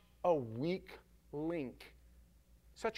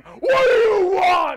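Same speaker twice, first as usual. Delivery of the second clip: slow, high, loud, menacing shout; scary demon shout; 19 dB louder, much slower than usual. Acted. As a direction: roar it to frighten him off. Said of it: "First they try to scare him".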